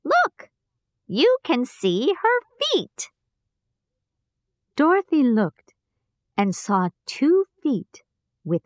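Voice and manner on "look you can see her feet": high, eager pointing out; lively, higher call to look; excited. Acted. Woman points at the feet.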